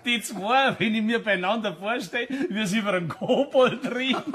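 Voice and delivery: gleeful laughing, picturing the scene; tickled, laughing at the image. Acted. Picturing the two masters meeting.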